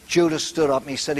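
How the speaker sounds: normal talking voice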